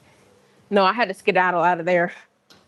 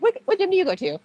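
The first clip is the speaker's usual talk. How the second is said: much higher, prying imitation of a guy at the gym; mock nosy guy; 9 semitones higher, faster than usual. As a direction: mimic a nosy guy fishing for details.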